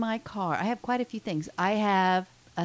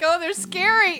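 mock scared; 11 semitones higher, 7 dB louder than usual. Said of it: "I'm like".

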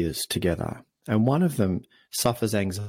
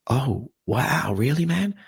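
surprised concern; 4 semitones higher, slower than usual. Retold or imitated. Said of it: "his friend goes".

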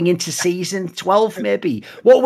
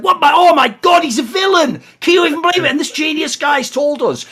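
squealing fake astonishment, pitched way up; mock shock; 11 semitones higher than usual. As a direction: play the crowd hamming up a shocked reveal. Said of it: "everyone in season eleven and twelve was like".

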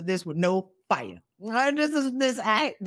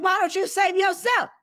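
mocking their question; 12 semitones higher than usual. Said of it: "they looking at you like".

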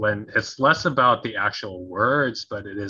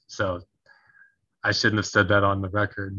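usual speaking voice

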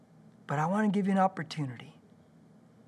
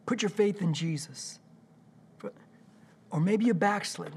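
usual speaking voice

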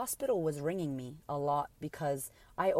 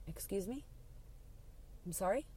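soft, higher questioning, halting; retold shocked, hushed query; stunned disbelief; 4 semitones higher, 8 dB quieter than usual. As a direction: barely take it in, voice lifting. Stunned wife hears of death.

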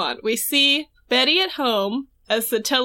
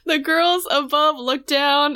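usual speaking voice

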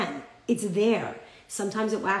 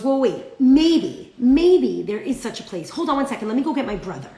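sudden realization; 3 semitones higher, 7 dB louder than usual. Acted. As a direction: halt everything as a new doubt dawns. Giant suddenly changes his mind.

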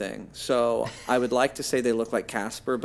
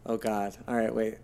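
ordinary talk, nothing marked

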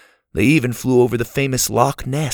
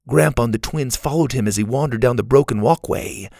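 plain reading voice

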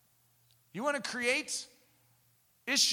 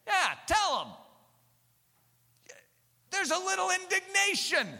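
indignant; 7 semitones higher, 5 dB louder, slower than usual. Real, not acted. Preacher voices indignation.

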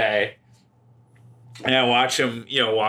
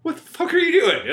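put-on yelling at kid; 5 dB louder than usual. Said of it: "I just knocked on the window, like".

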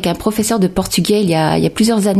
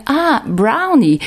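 higher, drawn-out American "brownie" of sudden recognition; American accent; 6 semitones higher, much slower than usual. Retold or imitated. Shop worker finally understanding the customer, American-accented brownie. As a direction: say brownie the American English way, slowly. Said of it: "la personne a fini par dire".

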